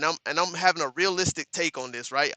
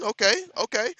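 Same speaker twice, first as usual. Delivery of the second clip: normal talking voice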